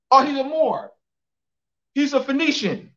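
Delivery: lightly put-on mocking voice of people labelling. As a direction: parody how they talk, half-joking. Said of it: "Talk like that".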